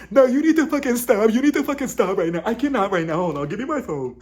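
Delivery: high, loud hammed-up fury; mock losing it. Woman accused by Whitney, mock hysterical outburst. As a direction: burst out high and loud, then demand the phone. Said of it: "Lisa, she loses it".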